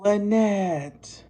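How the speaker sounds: mock agonized vampire cry; anguished cry. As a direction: wail "Lynette!" like a trapped vampire calling her. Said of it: "you hear him going".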